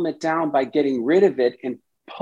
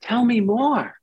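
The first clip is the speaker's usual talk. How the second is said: higher, inviting and curious; welcoming therapist; 6 semitones higher than usual. Shown as a demonstration. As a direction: invite the part in gently. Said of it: "We say".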